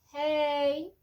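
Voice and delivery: summer personified says a bright loud hey; summer greeting. Retold or imitated. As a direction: greet like sunny summer arriving. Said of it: "the moment summer says".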